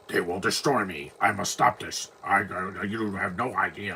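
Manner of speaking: mock dubbed villain voice. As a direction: imitate a bad dubbed actor. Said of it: "he sounds like this".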